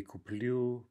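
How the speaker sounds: куплю with the beat on "лю"; end stress on куплю. Shown as a demonstration. Teacher showing the first person, куплю stressed on ending. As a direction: stress the last syllable, куп-ЛЮ. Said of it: "we have shifting stress".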